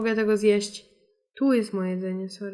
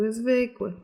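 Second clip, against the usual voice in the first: ordinary talk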